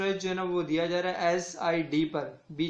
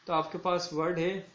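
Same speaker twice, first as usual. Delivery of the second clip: ordinary talk, nothing marked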